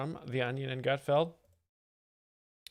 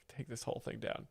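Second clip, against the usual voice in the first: usual speaking voice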